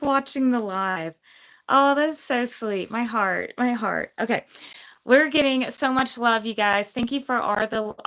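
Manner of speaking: usual speaking voice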